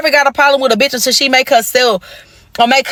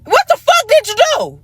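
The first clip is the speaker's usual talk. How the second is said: much higher, mocking his stalling; high mocking demand; 13 semitones higher than usual. Mocking her man's stammering, high and incredulous. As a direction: squeak out his stammer then pounce.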